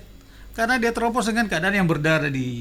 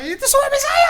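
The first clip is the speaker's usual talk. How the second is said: quoting her higher through laughter; can't keep a straight face; laughing; 5 dB louder than usual. Retold or imitated. Retelling the wife's line, laughing. (mengutip sambil tertawa)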